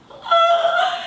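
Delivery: high, loud laughing gasp; overwhelmed by the director's praise, gasping; delighted gasp. Retold or imitated. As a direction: gasp in disbelief. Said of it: "overcome by Clint's praise".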